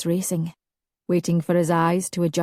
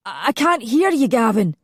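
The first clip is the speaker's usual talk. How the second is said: confused cry; 5 semitones higher, 3 dB louder than usual. A narrator's reading.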